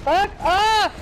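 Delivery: yelled high at the turret; angry shout. Real, not acted. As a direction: snap it out, fed up. Yelling at enemy turret.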